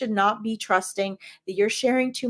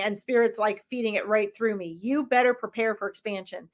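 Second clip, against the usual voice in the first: ordinary talk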